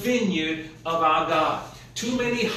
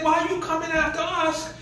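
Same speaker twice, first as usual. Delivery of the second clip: higher, put-on voice of the challenging tribesmen; raised pitch, acted tribesman's retort; indignant raiders; 9 semitones higher than usual. Danites challenge pursuing Micah.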